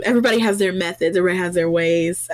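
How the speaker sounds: rattled off fast. Real, not acted. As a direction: toss it off quickly. Quick joking aside.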